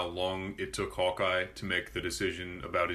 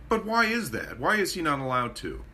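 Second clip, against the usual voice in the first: whiny customer; 6 semitones higher than usual. Retold or imitated.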